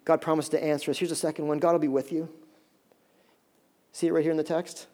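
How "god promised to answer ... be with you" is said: ordinary talk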